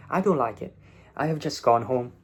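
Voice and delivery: ordinary talk, nothing marked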